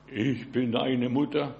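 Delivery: low, slow disguised voice; slow, deep impostor mother; devil posing as mother. Acted. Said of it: "der Teufel kann auch sprechen".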